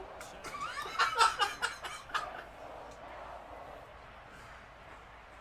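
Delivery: squeaky breathless laugh; high wheezing laugh. Real, not acted. Laughing fit.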